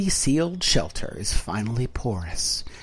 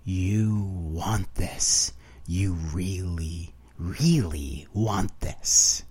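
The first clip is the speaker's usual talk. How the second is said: slow, much softer, drawn-out tempting voice; whispered; 6 semitones lower, 6 dB quieter, much slower than usual. A narrator's reading. Heart of desire tempting the narrator, a slow soft whisper. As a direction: tempt the listener softly and very slowly. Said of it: "whispered these words".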